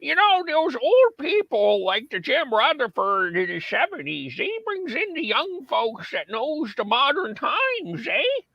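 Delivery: put-on high, slow very old man voice. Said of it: "I'm gonna use my old man voice".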